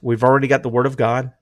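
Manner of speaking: ordinary talk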